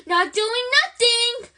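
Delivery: high, loud mischievous cackle; cackling poltergeist. A narrator's reading. Peeves mocking McGonagall with a high cackle. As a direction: sneer it out gleefully. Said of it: "cackled Peeves".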